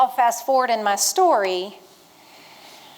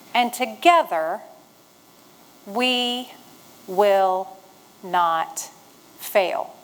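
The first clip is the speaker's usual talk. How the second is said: long pauses between each word, lower and lower; slow motto; 3 semitones lower, much slower than usual. Shown as a demonstration. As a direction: drop each word separately, sinking to the end. Speaker delivers motto with pauses.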